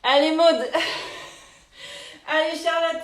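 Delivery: encouragement crié à Maud; cheering. Real, not acted. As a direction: crie « Allez, Maud » pour la motiver. Coach cheering a participant. (encouragement lancé fort)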